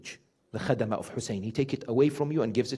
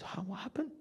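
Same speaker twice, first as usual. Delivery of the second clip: hushed question; 7 semitones higher, 17 dB quieter than usual. Retold or imitated. Son's astonished retold question.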